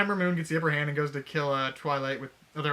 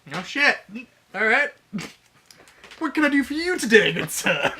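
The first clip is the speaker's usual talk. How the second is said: eager fawning; 7 semitones higher, 7 dB louder than usual. Acted. Princesses suddenly eager to please.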